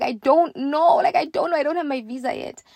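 high-pitched fretting; mock-panicked, pitched well up; retold panic. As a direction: replay your flustered inner wail. Panicked inner reply to their questions.

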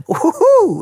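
high excited woohoo; whooping cheer. Real, not acted. Host cheering before the first listener letter.

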